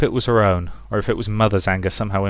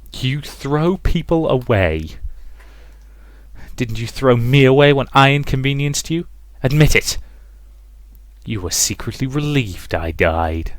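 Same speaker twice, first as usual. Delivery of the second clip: bitter accusation; 4 semitones higher than usual. A narrator's reading. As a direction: demand a confession, pitched up. Mother's voice accuses Rosa.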